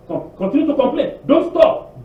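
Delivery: normal talking voice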